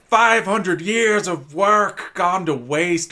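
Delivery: loud, slow, high lament over ruined work; anguished wizard wail. Retold or imitated. Wizard wailing at the thief over centuries lost, loud and slow. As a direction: drag out the despair like a ruined old sage.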